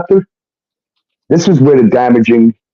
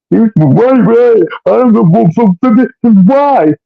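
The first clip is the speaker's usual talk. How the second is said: higher, hesitant imitation of her; mock flustered girlfriend; 8 semitones higher, slower than usual. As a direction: voice her caught off guard, pitched up. He voices girlfriend caught off guard.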